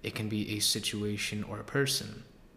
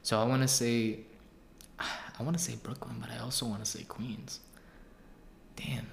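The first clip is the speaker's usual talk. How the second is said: ordinary talk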